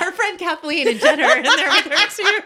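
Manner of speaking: high, loud laughing voice. Real, not acted. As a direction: joke through giggles. Joking about closet.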